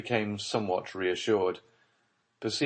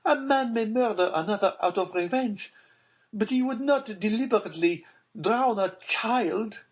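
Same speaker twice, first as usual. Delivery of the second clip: much higher, louder voice of a frightened mother; Baroness voice; 4 dB louder than usual. Acted. Baroness demanding of Villefort about her buried child, high and frightened.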